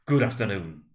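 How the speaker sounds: curt dismissal. A narrator's reading. Scrooge dismissing nephew.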